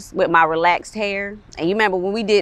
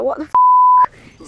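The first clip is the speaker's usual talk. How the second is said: shocked reaction; 9 dB louder, much slower than usual. Retold or imitated. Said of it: "they just turn around like".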